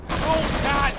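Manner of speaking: high, loud yelp of shock; shocked yell. Acted. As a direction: cry out in sudden horror. Sudden shock mid-sentence.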